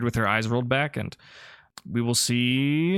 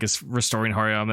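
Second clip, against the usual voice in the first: ordinary talk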